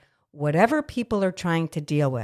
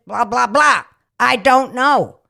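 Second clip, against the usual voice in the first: louder, higher mad outburst; 6 semitones higher, 8 dB louder, slower than usual. Real, not acted. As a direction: snap it out, fed up. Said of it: "but I'm mad right now".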